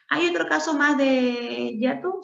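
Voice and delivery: lightly voiced student question. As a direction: play the curious pupil.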